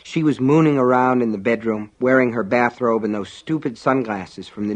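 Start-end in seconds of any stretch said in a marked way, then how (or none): none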